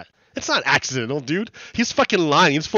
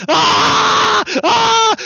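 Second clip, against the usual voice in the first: laughing shriek; 4 dB louder than usual. Real, not acted.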